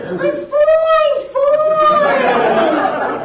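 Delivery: high, sing-song drawn-out reply; Long Island sophomore. Retold or imitated. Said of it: "my impression of two Boston University".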